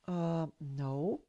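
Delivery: soft, halting retold reply; hesitant answer. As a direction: answer meekly after a drawn-out uh. Cowed answer to yelling doctor.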